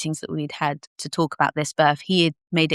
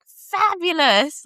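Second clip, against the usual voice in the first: high, very slow fabulous; effusive friend; 11 semitones higher, much slower than usual. Retold or imitated. Doula friend praising over the phone, slow high effusive. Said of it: "she's like".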